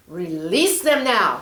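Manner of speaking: loud, slow command; commanding order. Acted. Angel Michael ordering the snake, loud and slow. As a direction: demand it with authority.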